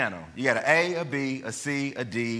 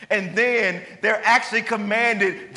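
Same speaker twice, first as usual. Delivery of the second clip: high, loud preaching build; fervent sermon climax; impassioned preaching; 7 semitones higher, 4 dB louder than usual. Acted. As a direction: drive the point home from the pulpit. Preaching at full force.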